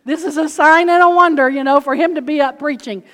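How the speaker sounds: loud, high fervent preaching. Acted. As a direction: preach it with full fire. Preaching at full force.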